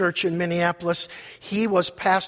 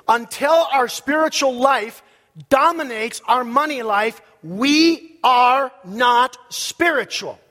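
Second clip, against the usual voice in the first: slow, raised, weighty preacher's delivery; emphatic preaching; 4 semitones higher, 5 dB louder, slower than usual. Real, not acted. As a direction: build to a loud, word-by-word verdict. Preaches at full force.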